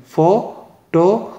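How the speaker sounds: Photographic in four parts, FO... TO... GRAF... IK; photographic with pauses between its four syllables. Shown as a demonstration. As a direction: slowly separate every syllable of photographic. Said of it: "चार syllable हैं".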